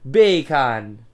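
"Bacon" in a loud mock Jamaican accent, second vowel open as "kahn". Shown as a demonstration. Host demonstrating the sound-alike, "Bacon" in mock Jamaican accent. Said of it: "bacon in a Jamaican accent".

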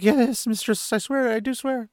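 frightened innkeeper swearing to Moraine, high and panicked; terrified pleading. A narrator's reading. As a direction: squeak it out in panic. Terrified innkeeper begging to leave.

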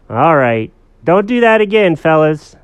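put-on firm but relenting little-girl telling-off; mild scolding. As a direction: let them off with a warning. Girl gives mild warning.

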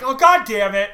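high, loud groaning curse; exasperated shout. Retold or imitated. Randy cursing as Disney boards the plane, loud exasperated shout. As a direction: blurt it out as Disney walks in. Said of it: "and he's like".

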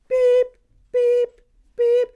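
loud, very high electronic beeps; microwave beep imitation. Telling how the microwave went off at night, high mimicked beeping. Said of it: "like, the microwave go off".

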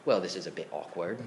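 lightly imitated British accent, low and quick. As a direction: quote the English preacher in a soft, understated British accent. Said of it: "he says in this great British accent".

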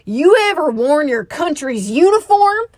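high, loud angry question; mock-furious veteran; rhetorical challenge. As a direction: throw it at him like a dare. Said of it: "[name] asked rhetorically".

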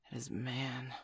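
quiet, grave warning; grim low. Acted. Mother Wolf warns what the tiger hunts, quiet dread. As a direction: let the dread sink in softly.

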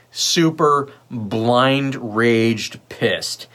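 drawn out word by word; very slow, weighty delivery; slow emphasis. Real, not acted. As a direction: stretch each word out very slowly for emphasis. Stressing how angry they got.

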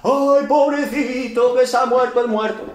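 slow, hammed-up fake lament; lightly voiced professional mourner's lament; mock mourner wail. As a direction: play a hired mourner faking grief. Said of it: "cuatro personas que nadie conocemos".

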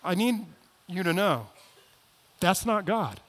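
normal talking voice

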